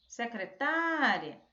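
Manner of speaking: secretária with a long, open TÁ; calling-out stressed syllable. Shown as a demonstration. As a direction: call out "secretária" slowly, stretching the á. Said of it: "chamando essa palavra".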